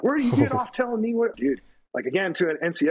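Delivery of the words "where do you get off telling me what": high-pitched mock yell at the sergeant; retold screaming. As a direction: shriek it like an outraged private losing it. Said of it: "screaming at him".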